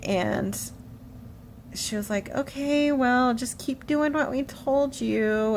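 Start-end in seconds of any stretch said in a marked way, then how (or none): 2.3-5.6 higher, slow, soothing voice; gentle reassurance. Retold or imitated. Nutritionist to a crying patient, gentle imitated reassurance. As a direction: imitate a kindly nutritionist calming someone.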